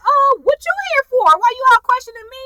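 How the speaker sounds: loud, high-pitched protest, acted out; mock indignant comeback. Son challenging his questioning parents, mock indignation. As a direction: throw it back at them, hammed up.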